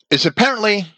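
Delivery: normal talking voice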